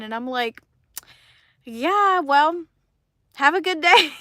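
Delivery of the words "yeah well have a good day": loud, higher, hurried goodbye; loud, awkward sign-off; awkward brush-off. Retold or imitated. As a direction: escape the conversation politely. Ending an awkward chat.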